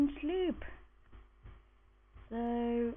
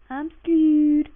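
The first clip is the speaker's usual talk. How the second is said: panicked; 4 semitones higher, 10 dB louder than usual. Real, not acted. Night falls, mobs coming.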